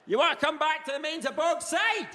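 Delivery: high shouted Perthshire come-on; unappealing chat-up yelled in Perthshire accent. Acted. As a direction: bellow the invitation like a Perthshire farmer. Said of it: "not in the Perthshire accent".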